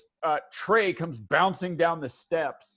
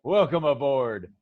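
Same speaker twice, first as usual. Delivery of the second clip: jolly crew greeting; 8 dB louder, slower than usual. Acted. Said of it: "And they say".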